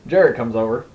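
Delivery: ordinary delivery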